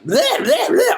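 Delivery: high mock bleating "bleh"; mocking hype noise. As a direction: parody over-the-top hype as gibberish. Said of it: "gotta be like".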